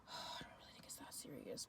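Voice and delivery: whispered, deflated, low; weary whisper. Retold or imitated. Giving in to the pushy guy, a weary whisper. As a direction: breathe it out as if giving up.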